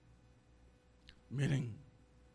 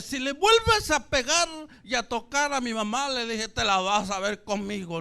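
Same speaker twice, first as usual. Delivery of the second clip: loud, raised warning to a father; retold threat; 3 semitones higher, 7 dB louder than usual. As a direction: act out a child's defiant threat. Kind droht dem Vater.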